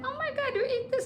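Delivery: high-pitched mock revulsion; judging disgust. Traveler judging the local food, disgusted. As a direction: squeal it, appalled at the food. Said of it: "you're judging right there and judging them".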